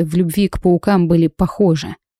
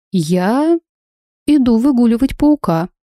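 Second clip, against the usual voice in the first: innocent, a touch higher; naive; 4 semitones higher than usual. A narrator's reading. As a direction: play dumb, sweetly naive. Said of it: "наивно хлопаю глазками".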